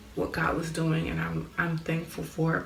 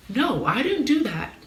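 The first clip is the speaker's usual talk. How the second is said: higher, louder, drawn-out protest; emphatic mock denial; 7 semitones higher, 5 dB louder, slower than usual. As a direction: act out an indignant denial, slowly. Retold denial of an accusation.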